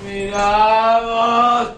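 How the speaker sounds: mocking laugh. Mocking her heartfelt gesture.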